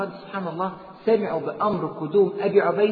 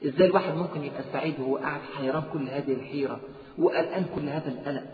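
ordinary delivery

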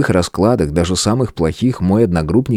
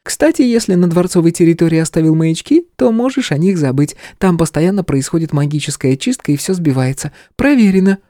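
light, higher female character voice; young woman voice; 12 semitones higher than usual. Acted. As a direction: voice her as a brisk young woman. (женский голос персонажа)

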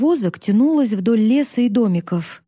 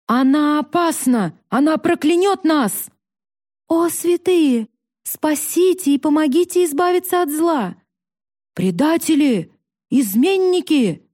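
alarmed crowd cries; 6 semitones higher than usual. A narrator's reading. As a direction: cry out in alarm, pitch well up. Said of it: "Крики доносились со всех сторон". (испуганные крики толпы)

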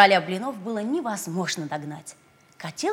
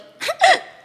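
sly giggle; 12 dB louder than usual. Acted. Pig laughs slyly.

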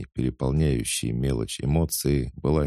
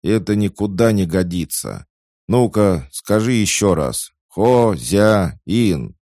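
loud, high-pitched telling-off; stern scolding; 7 semitones higher, 8 dB louder, slower than usual. A narrator's reading. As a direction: lecture a slow pupil firmly, loudly. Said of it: "ещё и строго выговаривал". (строгий громкий выговор)

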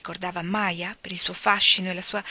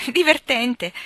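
bright, higher and louder; lively remark; 5 semitones higher, 8 dB louder, slower than usual. A narrator's reading. Fiona reacting to the letter about Bao Dai, politely delighted. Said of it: "disse Fiona".

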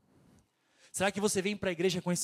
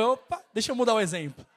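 laughing through the quote; 3 semitones higher, 6 dB louder than usual. Retold or imitated. Pastor laughing as he quotes [name]'s reaction, louder. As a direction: tell the guy's reaction while cracking up, loud.

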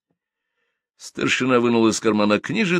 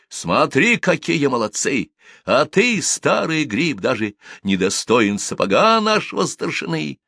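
proud boast; 6 semitones higher than usual. Acted. Imagined proud shout at old man.

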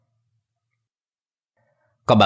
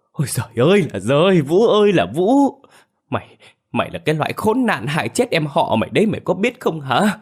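high wailing cry, louder, holding back tears; a father wailing and scolding his son through tears, much higher and louder; tearful bitter scolding; 9 semitones higher, 3 dB louder than usual. A narrator's reading. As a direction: wail at him in bitter grief, much higher. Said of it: "cố nén nước mắt mà cay đắng mắng Vũ".

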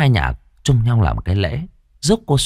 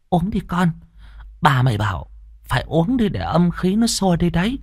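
put-on old grandmother; 7 semitones higher than usual.

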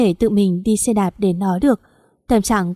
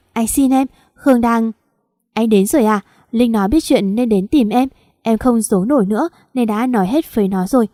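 ordinary delivery

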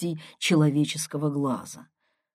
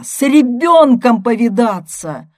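loud, raised bellow; angry yell; 6 semitones higher, 9 dB louder than usual. A narrator's reading. Brother demanding she see her child, yelling. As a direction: shout it at full force. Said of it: "заорал я".

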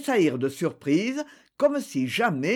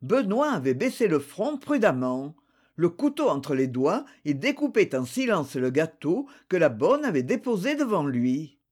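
plain reading voice

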